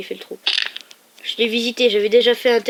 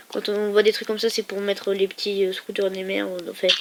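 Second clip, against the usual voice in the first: usual speaking voice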